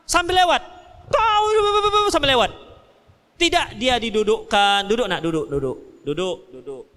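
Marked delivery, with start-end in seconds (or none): 0.1-2.5 raised preaching shout, higher; emphatic shout. Real, not acted. Preacher exclaims about angry parents. 4.9-6.8 put-on softer, lower, coaxing a child to sit; gentle parent.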